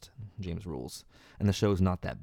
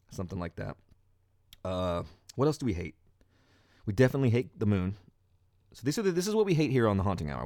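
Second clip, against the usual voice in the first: ordinary talk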